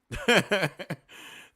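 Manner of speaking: amused, high chuckle; laugh. Real, not acted. Host laughing at the missing video, amused chuckle. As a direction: burst into a quick chuckle.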